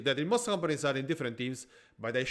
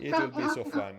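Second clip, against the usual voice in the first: laughing; 12 semitones higher, faster than usual. Real, not acted. Mocking echo, laughing.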